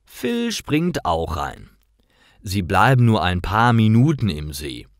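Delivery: plain reading voice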